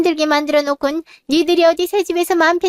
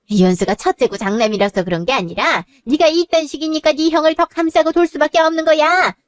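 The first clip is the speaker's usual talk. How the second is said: ordinary talk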